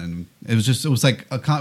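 ordinary talk

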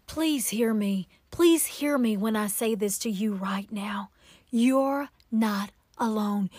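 hushed, deeply earnest comfort; tender plea. Real, not acted. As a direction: plead softly, close to the listener. Tender plea to struggling listener.

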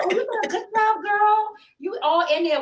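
high, singsong praise; gushing praise. Retold or imitated. Client to waxer mid-job, gushing praise. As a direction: cheer her on in a high, teasing lilt.

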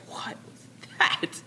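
slow, voiceless gasp of a question; hushed mock alarm on waking; mock freaked-out. Pharaoh wakes up from a weird dream, freaking out. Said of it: "he wakes up freaking out".

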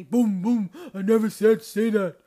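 loud booming voice of God scolding. Retold or imitated. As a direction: thunder it down like an angry God. God scolding in her head.

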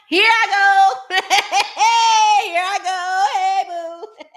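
high, loud, playful ratchet whoop; giggly hammed-up holler. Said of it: "I'm gonna little ratchet".

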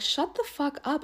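high, exasperated disbelief; retold exasperation. Telling off a man badmouthing his wife, high exasperation.

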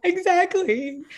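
high, drawn-out tearful agreement; mock crying. Agreeing with her teary best friend, mock crying. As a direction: wail it slowly in imitation of crying. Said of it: "Like, literally crying".

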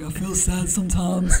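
mock-sad needy Shaq text; a hint of Shaq whining sadly; imitating Shaq. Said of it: "I'd be like, as if I was Shaq".

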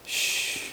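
whispered shh; hushing shh. Shown as a demonstration. Preacher showing the congregation how to hush, soft shh. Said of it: "the only response we can have is to just".

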